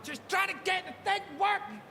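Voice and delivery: high, hurried protest; flustered explaining. Retold or imitated. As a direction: defend yourself, caught out and flustered. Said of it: "And I'm like".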